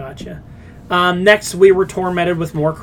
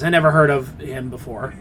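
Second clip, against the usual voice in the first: ordinary talk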